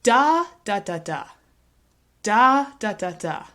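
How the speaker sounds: first "da" long, high and loud, next three "da, da, da" short and reduced; stress on first syllable. Shown as a demonstration. Teacher demonstrating stress timing, first "da" of four stressed. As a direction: punch the first "da", hurry the other three. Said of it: "make this a stress-timed word".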